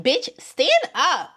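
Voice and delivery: exasperated outburst. Real, not acted. Exasperated outburst at cast member.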